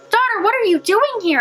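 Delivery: put-on high, urgent old woman's call; an old lady alarmed to find a girl; startled old housekeeper. A narrator's reading. Old housekeeper warning the girl, startled and high.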